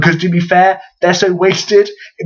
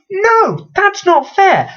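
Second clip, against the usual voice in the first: high-pitched indignant objection; squeaky mock outrage; mock protest; 13 semitones higher, much slower than usual. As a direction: protest in a much higher voice.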